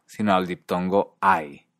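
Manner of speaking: usual speaking voice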